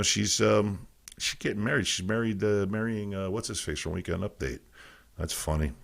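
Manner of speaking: normal talking voice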